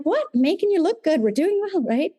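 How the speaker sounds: high, sing-song feigned innocence; wife feigning innocence to her husband, high and sing-song; mock innocent. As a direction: play dumb, all wide-eyed innocence. Wife feigning innocence at back-leading.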